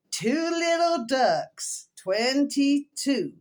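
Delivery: slow, drawn-out bingo number call; bingo caller. Retold or imitated. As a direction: call it out slowly like a bingo hall. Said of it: "one of these bingo callers".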